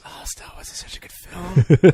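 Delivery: breathy laughing whisper of devotion; mock whisper. Real, not acted. Host whispering his love of Star Wars, laughing. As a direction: whisper it like a guilty confession of love.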